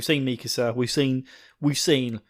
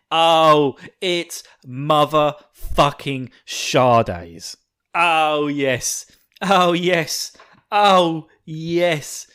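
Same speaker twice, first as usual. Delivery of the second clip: loud, high cries of delight; over-the-moon fan; ecstatic; 4 semitones higher, 7 dB louder, much slower than usual. Real, not acted. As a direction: shout your joy at the screen. Fangirling over the hero's entrance.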